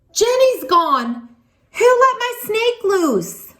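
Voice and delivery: high, slow alarmed cry; shouting. Acted. Emily shouting to the class that her snake is loose. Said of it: "Emily shouts".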